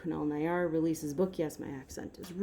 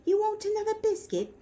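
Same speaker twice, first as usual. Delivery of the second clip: very high pet-talk voice; high sing-song baby talk; cooing to a dog. Acted. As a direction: coo a treat offer to a dog. Voices offering the dog a biscuit.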